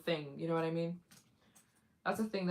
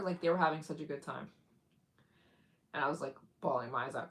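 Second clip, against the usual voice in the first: ordinary talk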